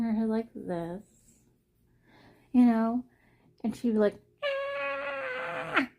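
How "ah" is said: very high mock cat screech; mock screech of an overdramatic cat; cat scream imitation. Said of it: "Screaming bloody murder".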